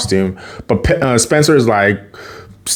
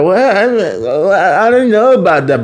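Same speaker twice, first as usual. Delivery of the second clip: higher-pitched, reluctant stammering; retold hesitant stammer; 7 semitones higher than usual. Friend balks at the dare, hesitant. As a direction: stall nervously in a mock higher voice.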